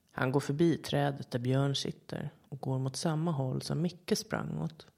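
plain reading voice

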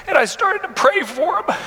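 barely getting the words out through tears; real emotion, voice catching; breaking down. Real, not acted. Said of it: "I'm sorry".

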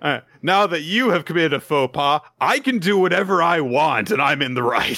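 high, loud smug hammed-up scold; mock bully. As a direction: gloat like a self-righteous bully. Imitating the bully woman.

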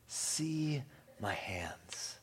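very slow whisper on "See my hands"; slowed, hushed, almost whispered "See my hands". Acted. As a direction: breathe "See my hands" tenderly and slowly. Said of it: "Read it again".

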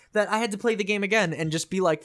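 usual speaking voice

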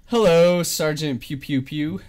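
loud, drawn-out hello; excited greeting. Real, not acted. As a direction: call out the name with glee. Greeting a friend in game.

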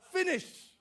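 high, loud final verdict; mock shout. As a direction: bark the sentence like a judge.

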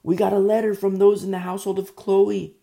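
lightly acted runner bringing news; urgent messenger. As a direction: call out, building to a loud, high announcement. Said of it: "somebody comes up, running up".

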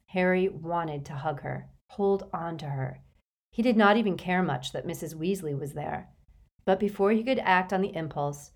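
ordinary delivery

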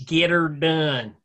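slow Southern drawl; Southern accent. Pretend. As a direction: stretch it out country style. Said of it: "How is my accent".

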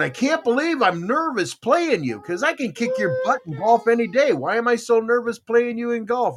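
jittery disbelief. Retold or imitated. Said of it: "And I'm going".

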